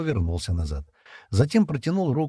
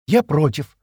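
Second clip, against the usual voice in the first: stubborn, raised and louder; stubborn objection; 7 semitones higher, 6 dB louder, slower than usual. A narrator's reading. Джемма opposing Стэн's plan, stubbornly. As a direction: put your foot down, pitch up. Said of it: "упрямо заявила Джемма".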